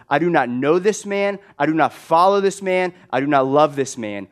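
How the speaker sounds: loud, emphatic acted disavowal; vehement denial. Peter cursing himself as he disowns Jesus, acted shout. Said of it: "rains down curses on himself and says".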